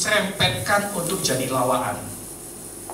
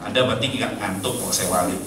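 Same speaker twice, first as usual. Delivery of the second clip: usual speaking voice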